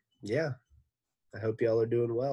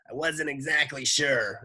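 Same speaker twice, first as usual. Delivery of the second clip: Miss Mush voice; 6 semitones higher, 5 dB louder than usual. A narrator's reading.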